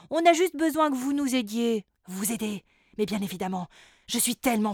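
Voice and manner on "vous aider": ordinary delivery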